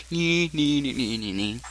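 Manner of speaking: silly nonsense noises; goofy repeated nonsense syllables; acting silly. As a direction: play it silly, sing-song nee-nee. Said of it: "acting silly".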